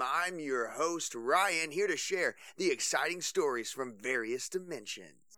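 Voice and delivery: high, bright DJ intro; radio host. Acted. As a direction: welcome the listeners on air.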